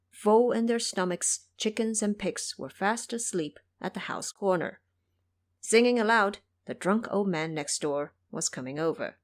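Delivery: usual speaking voice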